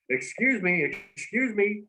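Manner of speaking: high-pitched "excuse me", imitating himself cutting in; high, polite mock interruption; retold interjection. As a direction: re-enact piping up to interrupt. Retells interrupting the presenter.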